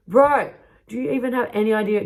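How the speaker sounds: loud, high mock outrage; exasperated scolding. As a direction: re-enact telling off a clueless friend. Retold scolding of the patient.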